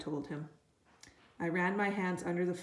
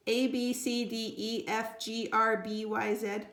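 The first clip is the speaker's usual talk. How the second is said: recited alphabet; 3 semitones higher, slower than usual. A narrator's reading.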